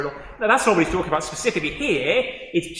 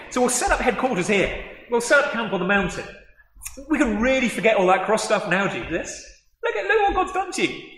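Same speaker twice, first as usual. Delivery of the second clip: higher, laughing, gushing, imitating Peter; excited Peter; 6 semitones higher than usual. Peter urging Jesus to stay on the mountain, giddy excitement. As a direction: play Peter, giddy and carried away. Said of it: "Peter is thinking".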